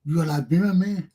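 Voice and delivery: ordinary talk, nothing marked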